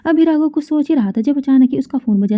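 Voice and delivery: ordinary delivery, nothing marked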